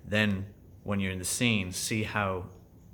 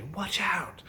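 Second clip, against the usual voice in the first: warning cry; 11 semitones higher than usual. Retold or imitated. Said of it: "like, say".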